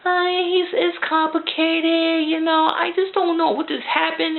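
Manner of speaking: mock the heroine's confused whimper; mock upset woman. Reviewer mocking Anna's upset words, high girly voice. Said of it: "She said / she's very upset".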